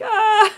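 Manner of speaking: high laughing cry of panic; laughing freak-out yelp. Retold or imitated. Mom overwhelmed by school notices, laughing yelp. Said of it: "I'm like".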